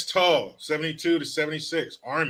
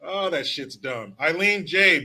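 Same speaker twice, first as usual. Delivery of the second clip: ordinary talk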